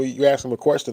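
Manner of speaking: ordinary talk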